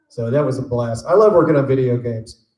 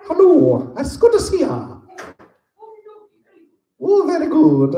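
silly high character voice; 3 dB louder than usual. Acted. Greeting fan in costume.

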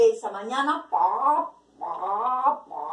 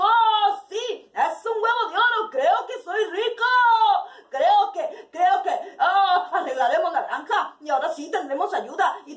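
agudo y fuerte, riendo de euforia; gritando como loco; 6 semitones higher, 5 dB louder than usual. Acted. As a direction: grita de alegría, se siente rico. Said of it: "empezó a gritar como loco". (el granjero grita como loco de alegría)

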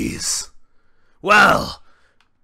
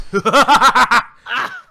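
louder mocking cackle; gloating laugh; 5 semitones higher, 4 dB louder than usual. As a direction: laugh at the fallen opponent.

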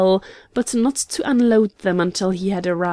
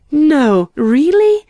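high, loud astonished question; much higher, louder surprise; amazed exclamation; 7 semitones higher, 8 dB louder, slower than usual. A narrator's reading. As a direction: exclaim in delighted disbelief. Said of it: "exclaimed Miss Morris".

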